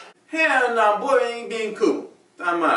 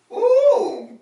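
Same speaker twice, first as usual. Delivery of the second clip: disgusted ooh; 4 dB louder than usual. Acted. Disgust in the toilet.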